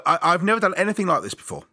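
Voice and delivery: normal talking voice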